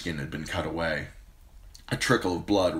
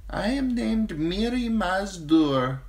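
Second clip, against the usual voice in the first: slave woman's voice; 11 semitones higher, slower than usual. Acted. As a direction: offer help meekly. Healer names herself.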